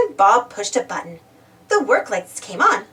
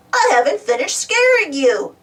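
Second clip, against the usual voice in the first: higher, mischievous shout; 5 semitones higher, 4 dB louder than usual. A narrator's reading. Spud to Muck running away, shouting. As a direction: call it out after them. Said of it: "Spud shouted".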